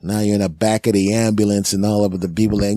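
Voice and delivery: ordinary talk, nothing marked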